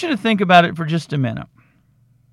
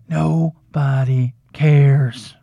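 slow, drawn-out; 5 semitones lower, much slower than usual. Real, not acted. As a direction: spell it out slowly for emphasis. Slow emphatic punchline.

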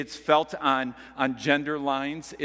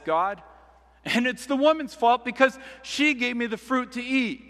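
Adam blaming; 6 semitones higher, 3 dB louder than usual. Retold or imitated. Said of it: "to blame both God and the woman".

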